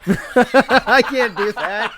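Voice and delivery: high, loud, laughing hard; helpless laughter through the words; cracking up. Real, not acted. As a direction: burst out laughing while protesting. Cracks up after sensual attempt.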